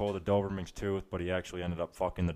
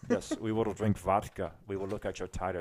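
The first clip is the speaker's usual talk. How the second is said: put-on Russian accent. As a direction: answer in a measured Russian accent. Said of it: "fucking Ra-, Russian".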